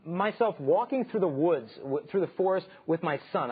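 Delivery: usual speaking voice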